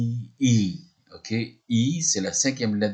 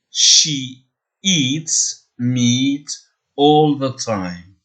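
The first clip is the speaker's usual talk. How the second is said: She eats meat all the time, slow and careful, louder; slow model sentence; 3 semitones higher, 6 dB louder, much slower than usual. Shown as a demonstration. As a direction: model it slowly for learners. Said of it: "je vous donne la phrase". (phrase modèle lente et articulée)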